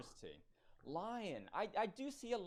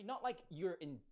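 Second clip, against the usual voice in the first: normal talking voice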